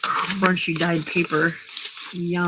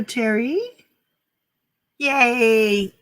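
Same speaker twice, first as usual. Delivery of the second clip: ordinary delivery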